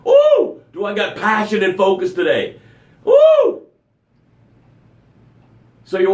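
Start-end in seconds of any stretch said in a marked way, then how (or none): 0.1-0.6 high excited ooh; a squealing ooh of delight. Real, not acted. Excited whoop before reading. 3.0-3.6 high shouted whoop; excited woo. Real, not acted. Hype whoop on the show.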